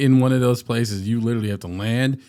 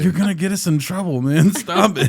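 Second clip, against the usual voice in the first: pleading; 6 semitones higher, 4 dB louder than usual. Retold or imitated. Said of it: "So I was like".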